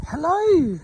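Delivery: high sing-song hello to the ponies; squeaky, loud, cooing hello; high greeting. Real, not acted. Greeting the miniature horses.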